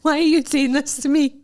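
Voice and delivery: high, loud plaintive protest; mock distress. Retelling her reply about a bad photo, mock distress. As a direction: wail it in comic outrage.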